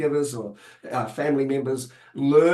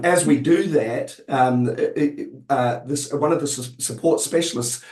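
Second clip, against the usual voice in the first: normal talking voice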